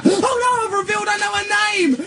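high-pitched comic alarm, stretched out; mock panic. Real, not acted. [name] realises he has revealed her name on the message, comic panic.

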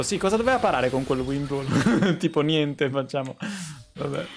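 ordinary talk

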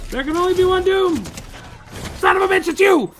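playful high-pitched outburst; mock-villain shout. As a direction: yell it like a showdown. Mock showdown with rival Doom.

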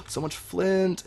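delighted gasp, then slow amazement; gasp of surprise. Real, not acted. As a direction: gasp as if spotting treasure. Finds lots of flint.

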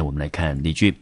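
ordinary talk

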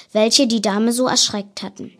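ordinary delivery

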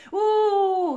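long, high, loud "ooh" howl; echoing wail. A narrator's reading. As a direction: join in the bawling on a held note. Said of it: "îi ținea isonul cealaltă". (urlet prelung, ascuțit)

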